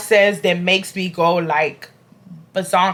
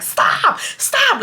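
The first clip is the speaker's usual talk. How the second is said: high-pitched mock yell; exasperated shout, held back in volume; imitated yelling. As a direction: imitate someone yelling to break up a fight. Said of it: "yell at both of us".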